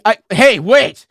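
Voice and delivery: loud, high shout to stop her; calling out. Acted. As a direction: call out sharply to get attention. Puppet calls out to her.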